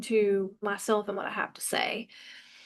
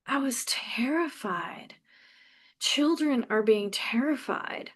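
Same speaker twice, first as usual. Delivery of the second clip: usual speaking voice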